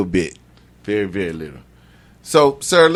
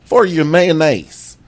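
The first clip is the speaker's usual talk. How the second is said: Florida cracker voice; 11 semitones higher, 4 dB louder than usual. Pretend. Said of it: "it's my Florida cracker voice".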